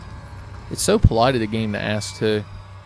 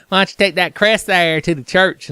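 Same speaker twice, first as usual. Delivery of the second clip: higher, louder nagging Hunnigan voice; Hunnigan impression; 7 semitones higher, 5 dB louder than usual. Hunnigan pushing Leon toward the church, higher, louder nagging. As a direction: nag him like a pushy handler. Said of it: "That's my Hunnigan".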